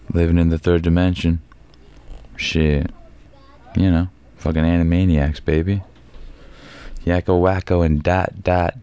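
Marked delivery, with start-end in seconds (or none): none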